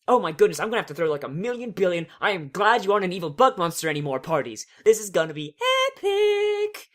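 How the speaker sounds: loud, fast, high gushing, sung epic; gushing Pinkie Pie. Acted. Pinkie Pie planning parties for the reformed changelings, gushing. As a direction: bubble over with party plans. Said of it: "says Pinkie Pie".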